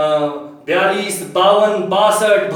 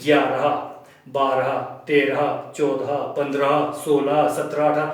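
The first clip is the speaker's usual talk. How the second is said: lower, unhurried counting; counting demo; 6 semitones lower than usual. Shown as a demonstration. Teacher counting eleven to eighteen in a lower, deliberate voice. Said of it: "for example".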